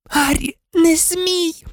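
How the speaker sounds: high, slow, drawn-out warning; alarmed forbidding. A narrator's reading. Hermione alarmed, forbidding Harry. (тривожна висока заборона)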